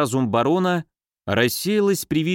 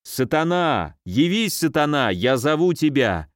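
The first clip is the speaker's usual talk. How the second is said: plain reading voice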